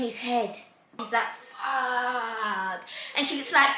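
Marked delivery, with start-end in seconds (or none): 1.5-2.8 low, soft, drawn-out curse; caught-out dismay. Retold or imitated. Jacob caught out scratching his head, dismayed. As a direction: imitate a sheepish groan under the breath.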